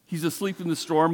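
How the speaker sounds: ordinary talk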